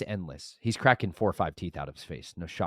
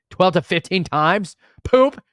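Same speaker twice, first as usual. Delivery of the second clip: high, loud and slowed down; incredulous shouted repeat; 11 semitones higher, 12 dB louder, slower than usual. Real, not acted. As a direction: blurt it out in comic outrage.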